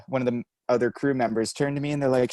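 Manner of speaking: ordinary talk, nothing marked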